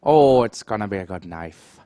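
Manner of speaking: put-on hammy Irish accent for the knife round. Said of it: "cast the entire game with an Irish accent".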